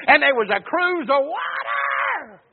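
high, slow wail on the last word; preacher's cry. Real, not acted. Preacher relates Elijah's provision, drawn-out preaching cry. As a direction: stretch the ending into a pulpit holler.

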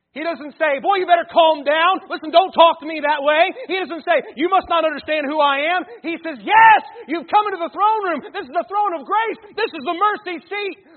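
loud, high, fervent preaching; preaching at full force. Acted. Pastor preaches to the congregation about anxiety, loud and fervent. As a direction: drive the point home to the whole church.

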